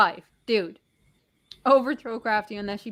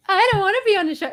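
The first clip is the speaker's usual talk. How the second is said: whiny tantrum; 9 semitones higher, 4 dB louder, much faster than usual. Pretend. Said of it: "he's throwing a tantrum now".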